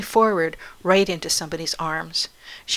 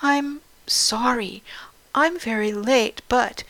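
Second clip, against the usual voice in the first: put-on higher, halting, apologetic girl; nervous stammer; 5 semitones higher, slower than usual. A narrator's reading. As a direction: falter timidly through an apology. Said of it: "stammered Katy".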